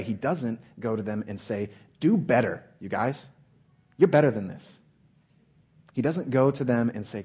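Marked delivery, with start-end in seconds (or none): 2.0-4.8 loud, punchy coach-like rebuke; mock scolding. Preacher acts out scolding sleeping disciples, loud and urging. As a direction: push them hard with a raised voice.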